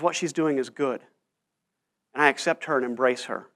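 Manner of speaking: very soft and tender; tender acceptance. Retold or imitated. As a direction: speak it like a quiet embrace. Jesus accepts the weeping woman.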